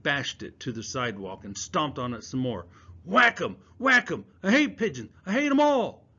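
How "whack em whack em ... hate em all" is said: loud, high furious chant; boy raging while crushing a can; angry yelling. Acted. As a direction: stomp and yell it out in rage. Stomping a can in rage.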